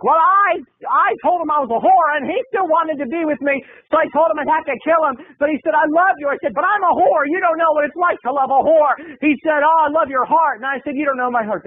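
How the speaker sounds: high, ranting crazy-lady voice; crazy duck lady. Retold or imitated. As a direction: babble like a madwoman arguing with herself. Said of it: "Missus Crazy Duck Lady is having a conversation".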